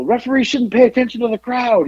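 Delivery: high-pitched mock yell, no real volume; imitated screaming. His dad heckles the referee, imitated screaming. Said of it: "he's screaming at him".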